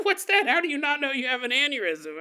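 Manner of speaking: high, quick, incredulous retelling; baffled disbelief. Retold or imitated. Acting own baffled earlier reaction.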